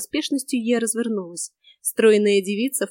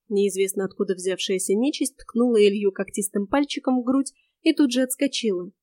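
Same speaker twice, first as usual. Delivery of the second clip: ordinary delivery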